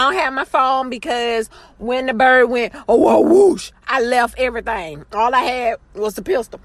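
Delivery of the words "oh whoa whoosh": normal talking voice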